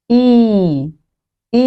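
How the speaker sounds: ই stretched long. Shown as a demonstration. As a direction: hold the vowel ই. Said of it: "লম্বা করে sound করতে হবে".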